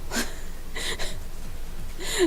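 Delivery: airy unvoiced laugh; breathy giggle. Real, not acted. Laughing at own weed joke.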